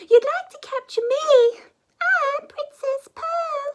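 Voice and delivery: put-on high, loud bright girl voice; princess Pearl. Princess Pearl offering herself to Zog, high and loud. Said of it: "she said".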